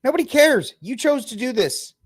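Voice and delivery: high-pitched, indignant retort; exasperated outburst. Real, not acted. Exasperated rebuttal to the clip.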